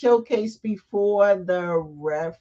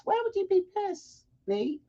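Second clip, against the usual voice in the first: very high, softer incredulous squeak; incredulous question; 14 semitones higher, 4 dB quieter than usual. Real, not acted. Host answering Nate's live comment, high and incredulous. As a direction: question him in a high, disbelieving voice.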